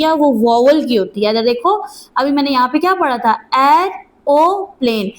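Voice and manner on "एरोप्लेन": एरोप्लेन slowly split into separate sounds, the ओ standing alone; syllable by syllable. Shown as a demonstration. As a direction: say एरोप्लेन in slow, separated pieces with a clear ओ. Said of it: "ये जो ओ की sound है". (एरोप्लेन को धीरे-धीरे, ओ की आवाज़ अलग करके बोलना)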